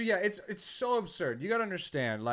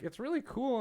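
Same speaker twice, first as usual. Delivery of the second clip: put-on high-pitched child voice; pitched-up kid impression; Yodel kid; 10 semitones higher than usual. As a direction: imitate a small boy giving a compliment. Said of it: "he was like".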